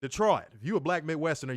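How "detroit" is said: Detroit said louder, the final t dropped; Detroit the local way. Shown as a demonstration. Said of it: "you gotta say it like that".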